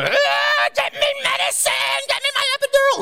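high, strained demonic screech; possessed. Retold or imitated. Wife in contractions demanding medicine, possessed shriek. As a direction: scream it like a woman possessed. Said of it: "becomes pretty much possessed at this point".

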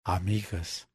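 débil, mucho más suave, desvaneciéndose; eco que se apaga. A narrator's reading. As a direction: deja que muera en un susurro de eco. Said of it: "muriendo débilmente". (eco que muere débilmente)

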